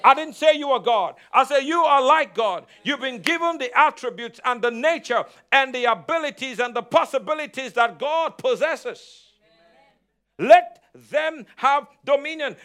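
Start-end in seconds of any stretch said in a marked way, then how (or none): none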